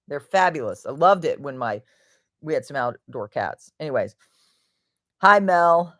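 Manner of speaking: usual speaking voice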